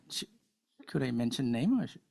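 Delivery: usual speaking voice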